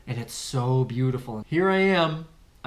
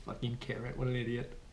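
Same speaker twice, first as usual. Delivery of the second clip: quiet, under-the-breath muttering; a soft muttered curse at his own carrot; self-muttering; 2 semitones lower, 11 dB quieter than usual. Real, not acted. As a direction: grumble it at yourself, much softer. Muttering at himself.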